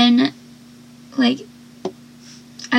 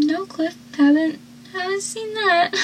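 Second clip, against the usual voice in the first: soft, high sarcastic reply; 6 semitones higher, 5 dB quieter than usual. Retold or imitated. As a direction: answer with dry mockery. Mocking reply to Cliff.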